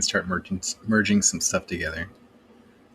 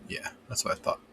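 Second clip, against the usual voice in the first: muttered under breath; whispering; 11 dB quieter than usual. Real, not acted. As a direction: whisper it to yourself. Muttered to himself, then repeated aloud.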